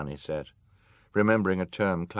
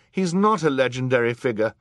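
indignant retort, higher and louder; 7 semitones higher, 9 dB louder than usual. A narrator's reading. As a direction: object hotly, punching NOT. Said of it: "Violet said indignantly".

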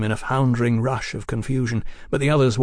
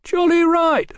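high, nervous shout; high-pitched, shaky bravado; shaking voice; 5 dB louder, slower than usual. A narrator's reading. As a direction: chime in with a shaking voice. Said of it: "his voice shaking".

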